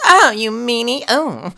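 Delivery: high, prissy mock whine; effeminate whine. Game character whining as enemies beat him, high and prissy. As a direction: whimper it like a pampered prince. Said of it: "horrible, like, effeminate accent".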